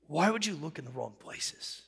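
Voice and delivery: quietly scolding himself in prayer; weary self-rebuke. Retold or imitated. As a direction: ask it as a sigh to yourself.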